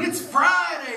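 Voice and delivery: high, loud cry of "It's Friday!"; preacher's yell. Retold or imitated. Pastor re-enacting the preacher yelling to his congregation, loud. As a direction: call it out to the whole church. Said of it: "he's yelling".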